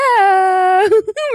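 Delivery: high cracking squeak into a laugh. Real, not acted. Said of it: "Me salió un gallo".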